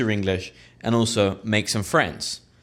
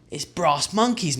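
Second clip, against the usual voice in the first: slow, a bit higher pub greeting; mild pub punter; 6 semitones higher, slower than usual. Shown as a demonstration. Punter walking into a Hackney pub, slow and higher. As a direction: say it like walking in from the cold.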